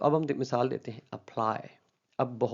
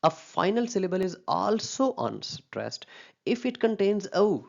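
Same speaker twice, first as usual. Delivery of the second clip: reading out a rule; 8 semitones higher, much slower than usual. Shown as a demonstration. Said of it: "दूसरा rule".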